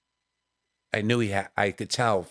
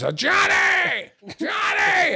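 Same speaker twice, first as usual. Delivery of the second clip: put-on loud, high, drawn-out drunken holler; drunk husband shouting; 5 dB louder, much slower than usual. Drunk husband hailing a comic at meet and greet, hollering. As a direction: holler the name like a drunk fan. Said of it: "the husband's drunk. And he goes".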